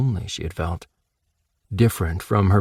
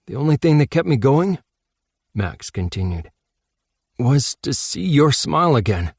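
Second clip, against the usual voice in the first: put-on higher, earnest boy voice; Max tender; 5 semitones higher than usual. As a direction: tell her tenderly what kept you going.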